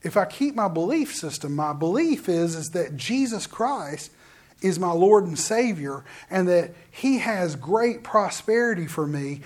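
normal talking voice

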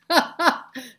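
high, loud real laugh; burst of laughter. Real, not acted. Teacher laughing as she recalls labor theater, high and loud. Amused at her own story.

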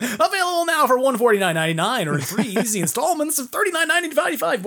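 high, hyped TV pitchman; infomercial. Pretend. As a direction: rattle off the price like an ad. Mock infomercial price pitch.

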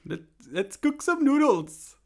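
high, slow, loud singsong Swedish Chef voice; Swedish Chef impression. Comedian dared by the host, loud high Swedish Chef voice. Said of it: "The Swedish Chef".

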